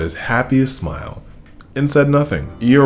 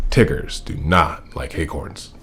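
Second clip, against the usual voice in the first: lower, softer and slow, firmly; 6 semitones lower, 4 dB quieter than usual. A narrator's reading. Tigger refusing the acorns, firm and slow. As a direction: put your foot down, slowly. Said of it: "said firmly".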